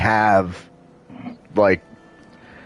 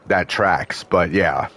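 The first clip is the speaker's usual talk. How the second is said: ordinary talk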